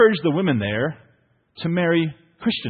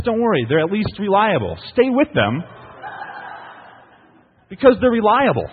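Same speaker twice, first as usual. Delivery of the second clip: higher and louder, hammed-up reassurance; mock reassuring sermon; 6 semitones higher, 5 dB louder than usual. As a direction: sell dull husbands as a bargain, overdone. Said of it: "he says".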